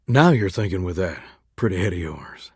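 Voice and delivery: deep, low crooner purr; low, smitten rumble; flirty. Acted. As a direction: drop way down to sweet-talk. Flirting with sweetheart.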